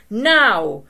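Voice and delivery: loud high shout. A narrator's reading. Fisherman shouting to open fire, loud. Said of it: "the fisherman shouted".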